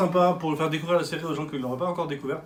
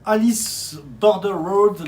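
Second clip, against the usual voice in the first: put-on loud, slow, higher title in careful English accent; showy English accent; 4 semitones higher, 6 dB louder, much slower than usual. Co-host announcing an English manga title, showy English accent. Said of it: "tu l'as dit avec un bel accent".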